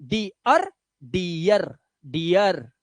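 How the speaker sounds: डियर said as dee-yer, repeated and falling; repeated model word. Shown as a demonstration. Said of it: "आवाज डियर ही निकलेगी".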